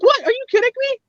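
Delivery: high, incredulous outburst; shocked disbelief. Retold or imitated. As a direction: squeal it out in disbelief. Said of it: "I'm like".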